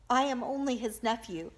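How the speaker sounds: plain reading voice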